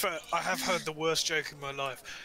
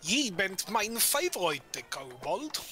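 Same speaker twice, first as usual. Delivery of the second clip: put-on slow, sing-song Scandinavian accent; a Scandinavian accent, drawn out; 4 semitones higher, slower than usual. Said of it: "I did it in a Scandinavian accent".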